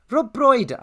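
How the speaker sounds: hammed-up Welsh lilt, ending high and loud; Rob Brydon. Shown as a demonstration. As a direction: try to find a Welsh accent by saying the name. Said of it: "I'm trying to do Welsh".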